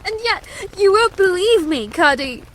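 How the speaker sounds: high, tearful princess protest; crying with vexation. Acted. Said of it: "now fairly crying with vexation and sorrow".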